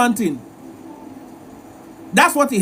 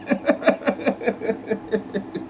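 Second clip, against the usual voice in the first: quiet laugh; 9 dB quieter than usual. Real, not acted.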